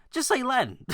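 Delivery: high, exasperated "just say Latin"; incredulous high-pitched punchline; emphatic punchline. Real, not acted. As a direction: throw "Latin" up high like the obvious answer. Punchline advice.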